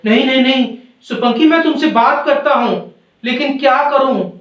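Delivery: high, urgent acted pleading; storybook cry of helpless protest. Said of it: "अंतरात्मा चिल्लाती है".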